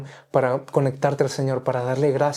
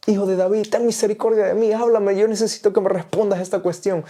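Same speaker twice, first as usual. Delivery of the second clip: high, pleading cry for mercy; imploring the Son of David, mildly tearful; retold tearful prayer; 6 semitones higher than usual. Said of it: "Y lloraba y decía". (súplica llorosa y aguda, recordada)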